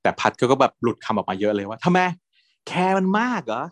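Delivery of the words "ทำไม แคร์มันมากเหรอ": high, menacing jealous taunt through a smile. Retold or imitated. Jealous drunk taunting his boyfriend, high and sneering. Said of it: "ยิ้มน่ากลัวมาก ยิ้มแบบหึงมาก".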